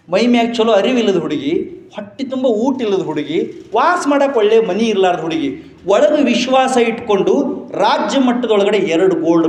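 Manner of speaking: rally the crowd with each line; fiery sermon climax; rousing preaching. Acted. Preaching build to triumph.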